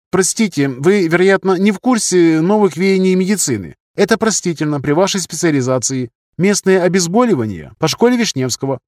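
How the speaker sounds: high-pitched junior doctor lecturing a senior; self-assured young surgeon. Acted. (молодой хирург, высоким голосом, поучающе)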